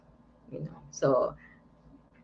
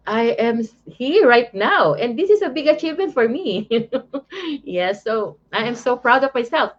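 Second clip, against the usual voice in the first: laughing; 7 semitones higher than usual. Real, not acted. Proud, giggly achievement confession.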